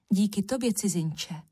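ordinary delivery

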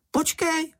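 very high-pitched call to wait; alarmed cry. A narrator's reading. Čenich calls after running Mumínek, high alarmed cry. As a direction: cry out in a small panicky voice. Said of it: "vykřikl Čenich".

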